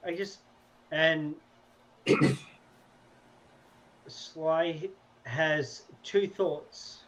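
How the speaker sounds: ordinary talk, nothing marked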